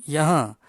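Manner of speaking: "yaha" loud, with a nasalised final vowel; nasal ending. Shown as a demonstration. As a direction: let the end of "yaha" hum through the nose. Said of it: "Belakang dia ada nun gunnah juga". ("yaha" dengan nun gunnah di hujung)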